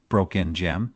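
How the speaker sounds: ordinary delivery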